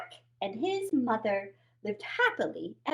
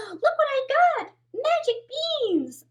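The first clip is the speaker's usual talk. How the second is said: put-on higher, louder, thrilled kid voice; excited boy; 11 semitones higher, 4 dB louder than usual. Boy showing his mother the magic beans, high and thrilled. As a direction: show off the prize breathlessly.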